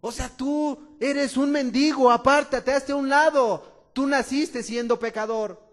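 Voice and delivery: loud, harsh scolding; scolding rebuke. Acted. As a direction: shut the beggar up. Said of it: "Lo reprendían".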